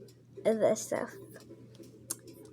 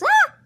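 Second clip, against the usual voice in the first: sudden high loud shriek; startled scream; 12 dB louder than usual. Pretend. Slipping near the window, a high startled shriek. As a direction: let out a sharp scream as you slip. Character almost falls.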